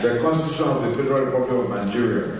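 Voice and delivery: administer the oath solemnly, phrase by phrase; solemn oath swearing. Real, not acted. Governor administers oath of office.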